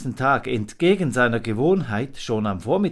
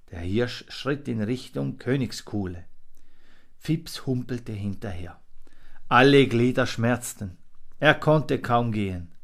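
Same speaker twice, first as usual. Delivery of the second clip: usual speaking voice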